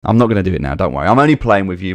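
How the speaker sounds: normal talking voice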